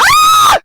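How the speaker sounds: very high, loud shriek; piercing frightened scream; real scream. Real, not acted. Screams touching the tarantula.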